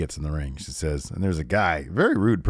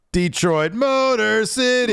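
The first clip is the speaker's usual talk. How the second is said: higher, drawn out Motor City, like a wrestler working the crowd; mock promo call; 13 semitones higher, 4 dB louder, slower than usual. Host imitating Sonya calling out the Detroit crowd, drawn-out promo call. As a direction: stretch Motor City slowly and high.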